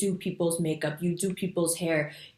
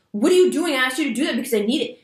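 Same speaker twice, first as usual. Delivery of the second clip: louder, higher, rapid mock scolding; imitated yelling; 6 semitones higher, 6 dB louder, faster than usual. Someone demanding a job done while interrupting, mock yelling. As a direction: snap the demand out as an acted yell. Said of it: "if someone had yelled at me".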